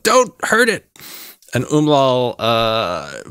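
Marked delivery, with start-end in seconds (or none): 0.0-0.9 high, urgent character shout; yelled warning. Acted. Kachka warns the party off the incapacitated Roper, yelling out. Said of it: "Kachka yells out".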